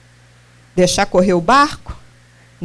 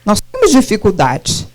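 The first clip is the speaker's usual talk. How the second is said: ordinary delivery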